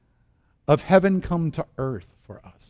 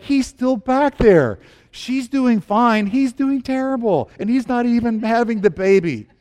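high, slowed, raised report; exasperated calling; 8 semitones higher, 4 dB louder, slower than usual. Acted. Staff reporting the husband doing worse than wife, exasperated calling. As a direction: call back with mock exasperation.

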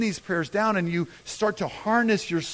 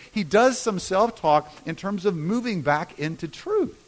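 normal talking voice

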